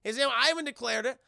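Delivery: high, loud calming rush; placating whoa. Retold or imitated. Johnny stopping a fight, high hurried whoa. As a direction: hold everyone back fast. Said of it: "Johnny's like".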